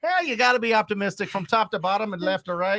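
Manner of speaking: folksy Scottish-Irish brogue; mock Scottish accent. Host riffs on the quote for laughs, mock Scottish accent. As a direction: riff like a Scottish leprechaun. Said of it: "read it in a Scottish accent".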